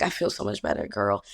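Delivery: low, drowsy-relieved patient voice. Retold or imitated. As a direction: imitate a comforted patient, pitch dropped low. Relieved patient waking, voiced in story.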